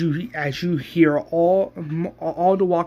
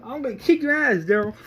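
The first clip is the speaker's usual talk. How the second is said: much higher, loud threatening yell; retold screaming; 13 semitones higher, 6 dB louder, faster than usual. Picking a fight with Daryl, high loud threatening yell. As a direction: shriek the threat at him. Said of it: "she's screaming".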